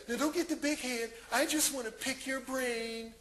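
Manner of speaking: woman's voice. Retold or imitated. Woman inviting him out while claiming innocent interest, imitated woman's voice. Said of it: "She's like".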